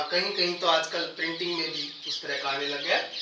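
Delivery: ordinary delivery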